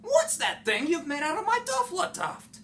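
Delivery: the Lorax scolds the Onceler, shouting and puffing. Acted. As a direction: puff up and shout in outrage. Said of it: "as he shouted and puffed".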